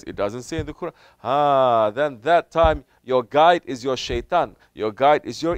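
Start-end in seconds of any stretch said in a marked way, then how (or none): none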